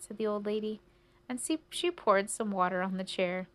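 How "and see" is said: usual speaking voice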